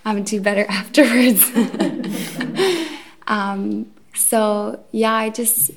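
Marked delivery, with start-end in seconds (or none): 1.6-3.0 a quick self-conscious laugh that falls away; short embarrassed laugh. Real, not acted. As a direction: laugh it off lightly. Laughs after her confession.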